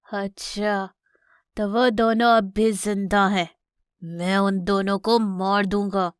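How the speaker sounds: slow, threatening villain voice; menacing villain lion. Acted. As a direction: plot a killing, drawing out the threat. Villain lion plots to kill them. (खलनायक शेर की धमकी भरी आवाज़)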